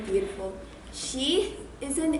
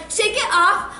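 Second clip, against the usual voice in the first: imitating [name]; 8 semitones higher, 7 dB louder than usual. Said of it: "saying things like".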